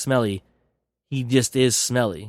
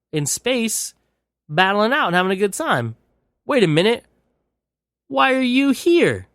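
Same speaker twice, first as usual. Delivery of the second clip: dopey replicant; 8 semitones higher than usual. Retold or imitated. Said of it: "Beta Alex is like".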